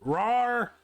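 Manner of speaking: high, playful little roar; a cute pouncing rawr; cartoon kitten roar. Shown as a demonstration. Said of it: "furry awakenings".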